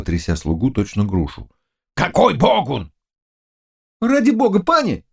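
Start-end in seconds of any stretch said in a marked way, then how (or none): 1.9-2.9 raised, rough interrogation; angry demand. Acted. Zagloba shaking the servant. 4.0-5.1 high-pitched, pleading servant; exclaiming. Acted. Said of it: "воскликнул Жинзян".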